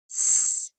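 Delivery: voiceless "S" held as a hiss; hissed s sound. Shown as a demonstration.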